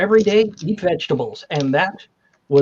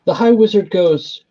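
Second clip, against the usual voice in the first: ordinary talk, nothing marked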